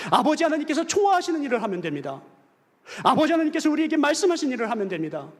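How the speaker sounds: loud, high, fervent exhortation; full-force preaching. Acted. Pastor urging the congregation to boast in God, full-force preaching.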